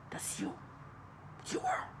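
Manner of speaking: hushed whispered accusation. Retold or imitated. Woman whispering an accusation during a movie, hushed whisper. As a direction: breathe it out as a soft whisper. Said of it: "And just whispering".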